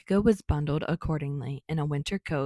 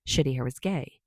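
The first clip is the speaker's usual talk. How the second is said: plain reading voice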